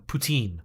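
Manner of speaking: Anglicized poutine with a long ee; poutine as "poo-teen". Shown as a demonstration. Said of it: "Everybody in the world says poutine".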